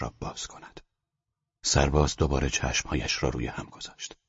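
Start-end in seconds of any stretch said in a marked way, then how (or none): none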